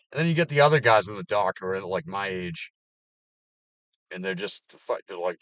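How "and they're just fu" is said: normal talking voice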